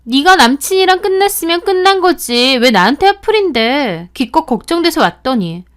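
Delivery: high, loud angry yell; loud, high outraged "야"; furious retort. Acted. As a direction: turn the blame back on her, furious. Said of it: "본인이 더 화를 내더라고요".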